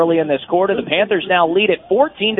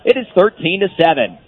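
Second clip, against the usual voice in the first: usual speaking voice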